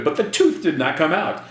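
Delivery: usual speaking voice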